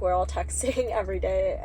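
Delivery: normal talking voice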